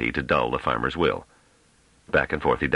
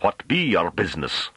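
lightly voiced higher country challenge; challenging rustic farmer; 5 semitones higher than usual. A narrator's reading. Farmer challenging the scholar's errand, pushy. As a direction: demand it in country dialect.

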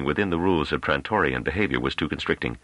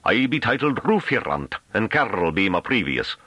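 higher rustic Hamish voice, throaty rolled R's; thick Hamish accent; 7 semitones higher than usual. A narrator's reading. Farmer introducing himself to a stranger, thick Hamish accent. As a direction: roll the R's in broad Hamish. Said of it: "His accent became noticeably more Hamish. His R's rolled throatily".

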